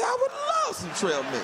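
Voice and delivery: hammed-up eager yelp; overeager. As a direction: jump at the offer delightedly. Overeager acceptance of snack.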